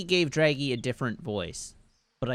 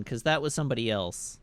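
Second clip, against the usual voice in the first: usual speaking voice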